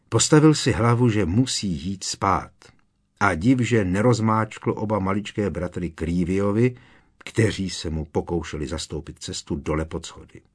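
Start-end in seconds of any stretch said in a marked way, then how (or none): none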